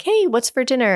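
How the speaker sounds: imitating the husband's cheery question; casual husband. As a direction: toss it off like he just walked in. Husband voiced casually asking.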